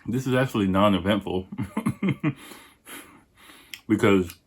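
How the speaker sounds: usual speaking voice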